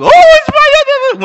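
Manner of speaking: very high, loud, excited dog voice; overjoyed dog. Retold or imitated. Dog greeting owner coming into the house, overjoyed squeal. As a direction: gush wildly at the owner's arrival. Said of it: "When my dog would come into".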